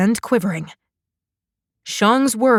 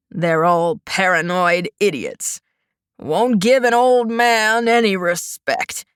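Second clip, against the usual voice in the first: put-on wheezy old man; 3 semitones lower than usual. A narrator's reading. Old man figure in story.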